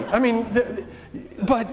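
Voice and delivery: ordinary delivery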